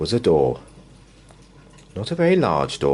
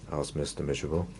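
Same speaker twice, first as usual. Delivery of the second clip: ordinary delivery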